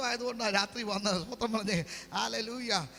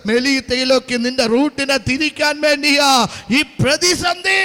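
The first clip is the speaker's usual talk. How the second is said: full-force preaching; 11 dB louder than usual. Real, not acted. Preacher telling believers the crisis has a purpose, loud rising preaching.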